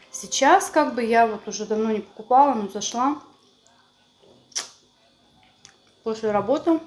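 chewing while talking; eating dumplings between words; mouth full. Real, not acted. Seen 1: Bites dumplings at 1.1 and 6.9 s, chewing between.

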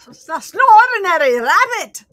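loud, high mock warning; Monty Python quote. Warning of the killer rabbit, hammed-up quote.

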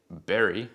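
"berry" with a b, both lips closed; "berry" opens with a firm b; b sound in berry. Shown as a demonstration. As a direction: start "berry" with the lips pressed together. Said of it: "suenan diferente".

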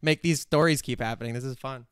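normal talking voice

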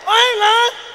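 high, loud insisting; excited insist. Real, not acted. Comedian insisting to the audience, high and loud. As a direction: swear it's true to the crowd. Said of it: "insisting to the crowd".

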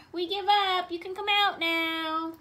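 loud, high, slow singsong call. A narrator's reading. Elmer surrenders to hidden cousin, loud drawn-out call. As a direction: call it up to someone hiding. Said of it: "called Elmer".

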